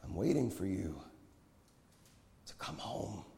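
soft invitation fading to a whisper; tender appeal. A narrator's reading. Prophet looks his friend in the eyes, tenderly appealing. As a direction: let the last words sink to a whisper.

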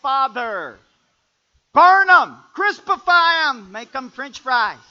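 shouted mock prayer, high and loud; mock wrong Jesus. Shown as a demonstration. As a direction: ham up the wrong version for laughs. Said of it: "No, what did he say?".

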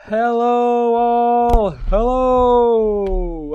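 high, loud showman hello; announcer greeting. Acted. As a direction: greet the crowd like a stage host. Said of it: "Very, very good".

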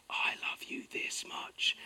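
soft, slow whisper of love; whispered to kids. Retold or imitated. Dad whispering to his little kids, a slow hushed whisper. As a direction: breathe it gently like a bedtime secret.

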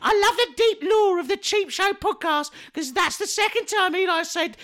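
squeaky high mocking voice; high silly voice.

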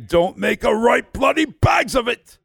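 high shouted Irish brogue; Irish catchphrase shout. Acted. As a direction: yell the catchphrase in an Irish accent. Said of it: "A K A Potatoes O'Brien".

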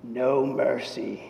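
low, slow, hushed line; Mister Miyagi. Retold or imitated. Miyagi quietly tells the beaten rival, low and slow. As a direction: lean in low and let each word drop slowly. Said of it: "he says to him".

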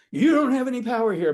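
loud, high, pushy declaration; shouting it to sound powerful; forceful shout. Shown as a demonstration. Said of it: "Not like".

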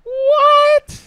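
high, loud indignant what; outraged disbelief; shocked what. Real, not acted. Friend claims others beat it.

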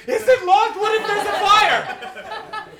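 high, loud mock panic, slow; hammed-up fretful Brit; panicky British punter. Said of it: "most U K thing".

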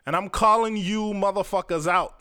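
ordinary talk, nothing marked

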